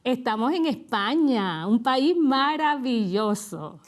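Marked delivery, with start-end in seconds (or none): none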